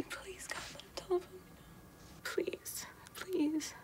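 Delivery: begging in a hushed whisper; whispered plea. Acted. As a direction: whisper the prayer, almost no voice. Begging God for the call.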